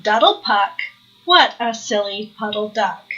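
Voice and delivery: plain reading voice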